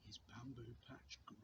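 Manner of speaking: a soft whisper as the bamboo grows; hushed whisper. A narrator's reading. Storyteller whispers to children, softly hushed. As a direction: let it fall to a hush. Reading a picture book aloud.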